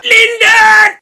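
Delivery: anguished shout. Real, not acted. Narrator reacting to Lyndon being shot, shouting. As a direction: shout his name as he's hit.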